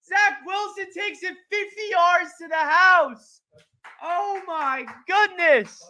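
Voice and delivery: high, loud, shouting play-by-play call; excited play-by-play. Real, not acted. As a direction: call the run like a stunned announcer. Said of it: "you're doing the play-by-play".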